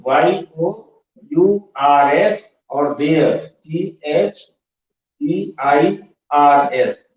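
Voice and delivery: letter by letter, very slowly, for students to copy; teacher spelling each letter. Shown as a demonstration. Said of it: "फिर से बड़े अक्षरों में लिख देता हूं".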